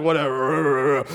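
low, slow, bored dismissal; deadpan shrug of a word, dropped low; stiff indifference. Retold or imitated. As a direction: play the unmoved stiff in the pews. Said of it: "total stiff and you're just sitting there like".

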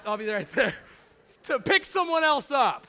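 hammed-up cheerful promise, laughing; laughing joke. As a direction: ham it up with a laugh. Joking about jail pickups.

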